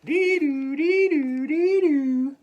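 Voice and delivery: loud, high siren wail; fire truck siren imitation. Fire truck speeds away in snow, high siren. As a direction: wail two notes like an engine. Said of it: "sounding its siren".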